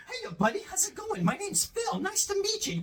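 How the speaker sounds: put-on high, soft, chirpy clean-cut kid voice; wholesome 1950s kid. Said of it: "the equivalent of "Hiya, pal".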